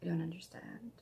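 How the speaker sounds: a soft, puzzled whisper; whispered aside. Real, not acted. Stitcher confiding to viewers about Ewoks, whispering.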